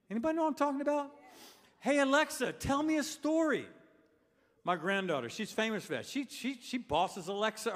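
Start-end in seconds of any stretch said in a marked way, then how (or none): 1.8-3.7 high, sustained call; calling to a device. Acted. Preacher demonstrating talk to Alexa, high-pitched call. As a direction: pitch it up as if summoning a gadget.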